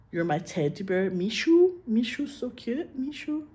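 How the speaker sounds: high, slowed cutesy coo on Mishu; cooing pet names. Shown as a demonstration. Cooing pet names to a loved husband, high and cutesy. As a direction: coo it like baby talk to a sweetheart. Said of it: "you can say".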